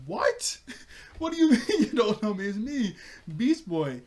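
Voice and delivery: loud, high-pitched mock disbelief; hammed-up stunned outcry; shocked protest. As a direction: blurt it out, laughing through it. Acts Beast Boy's shocked protest.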